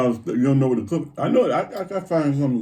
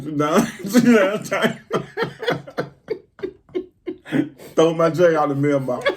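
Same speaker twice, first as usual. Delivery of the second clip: laughing; 5 semitones higher, 5 dB louder, slower than usual. Real, not acted. Laughing mock order.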